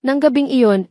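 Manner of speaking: normal talking voice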